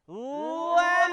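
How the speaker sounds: long, high, loud cry of a name; desperate full-voiced call; almighty cry. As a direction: scream his name into the smoke. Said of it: "Lila gave one last almighty cry".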